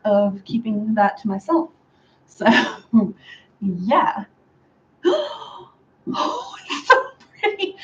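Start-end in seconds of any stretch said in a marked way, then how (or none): 5.0-7.8 high gasp of delight; gasp, then gush in a high little voice; awed delight. Real, not acted. Admiring her own pretty hair, delighted and high.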